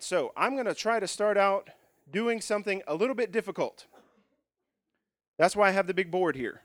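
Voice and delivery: normal talking voice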